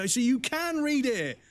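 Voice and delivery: high, drawn-out mock hallelujah; sarcastic rejoicing. Patient mock-praising a doctor who learned to read, sarcastic. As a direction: proclaim it slowly like a miracle, dripping with sarcasm.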